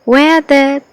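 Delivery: usual speaking voice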